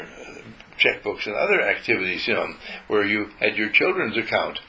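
ordinary delivery

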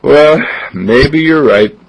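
slow, conceding mother; mother conceding slowly to dad. Acted. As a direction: give in slowly. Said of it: "Mama looks and says".

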